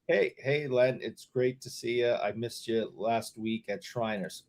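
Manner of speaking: quiet, slow, innocent greeting; soft friendly quote. Retold or imitated. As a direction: say it softly and sweetly. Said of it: "I just said".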